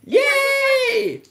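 very high happy cheer; excited cheer. Real, not acted. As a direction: squeal a happy yay. Cheering shopping.

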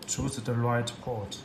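ordinary talk

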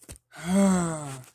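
loud heavy sigh; frustrated sigh. Real, not acted. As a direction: let out an exasperated sigh.

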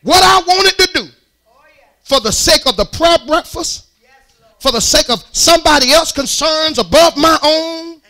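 high, loud, slow preaching cry; preaching at full force. Acted. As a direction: hammer home the self-denial to the congregation.

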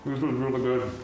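talking through a mouthful of food; chewing while speaking; mouth full. Real, not acted. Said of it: "Sorry to talk with my mouth full".

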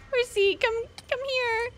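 high-pitched call to a pet; calling a horse. Real, not acted. Player summons her horsey in the game, calling out high.

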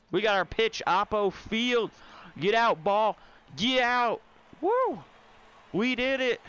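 rising, loud, high cheer as the ball flies out; excited cheering. Real, not acted. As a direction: urge the ball out, then whoop in triumph. Home run celebration building up.

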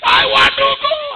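put-on high, loud wailing child's cry; mock sobbing shout of a child; crying plea. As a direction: wail it like a homesick girl. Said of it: "she would just ask".

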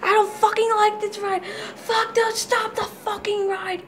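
real panicked yells on a coaster; on a roller coaster. Real, not acted. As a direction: scream through the drops and loop. Screaming on a drop and loop.

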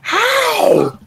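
very high, disbelieving cry; incredulous. Real, not acted. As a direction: blurt it out, totally baffled. Baffled by opponent's game progress.

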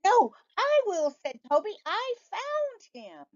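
put-on high kid's voice insisting; high child insisting; squabbling boy. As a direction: claim it like a sibling. Brothers squabbling.

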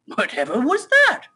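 high, rising startled question; startled cry. Acted. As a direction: cry out at a sudden thud. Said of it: "he cried".

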